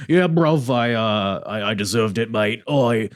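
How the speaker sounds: attempted English accent, slightly off from his own voice; a rough go at a British bruv voice; half-hearted London accent, lightly done; Will Ospreay accent. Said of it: "I'm trying to do my Will Ospreay accent".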